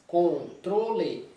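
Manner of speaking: Controle spoken slowly as con-tro-le, each syllable apart; syllabify Controle, three beats; split into syllables. Shown as a demonstration. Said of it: "são três".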